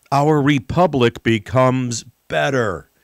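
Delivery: normal talking voice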